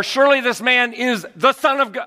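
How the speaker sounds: "surely" stressed, falling to God; stress demo. Shown as a demonstration. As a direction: lean on "surely" loudly.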